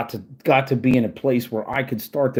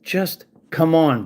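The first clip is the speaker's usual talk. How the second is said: slow weary plea; 3 semitones higher, slower than usual. Real, not acted. Ranting at troll Dylan, slow weary plea. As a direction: come drawn out slow and tired. Exasperated rant at an online troll.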